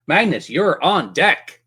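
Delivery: usual speaking voice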